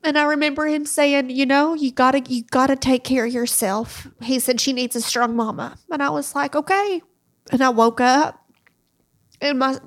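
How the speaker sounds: real tearful retelling, almost crying; holding back tears. Real, not acted. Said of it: "I'll try my best to say it without crying".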